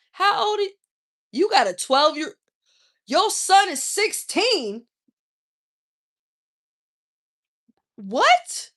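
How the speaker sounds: high, loud disbelief; loud, high-pitched shocked challenge; incredulous outrage. Acted. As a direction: raise the voice in scandalized questioning. Mock outrage at older man's slang.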